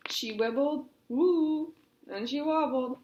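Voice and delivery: wobbly, playful sway in the voice; wobbly narration. A narrator's reading. Narrator acts out Poppy wobbling on stone, wobbly and playful. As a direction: act out the wobble as you read.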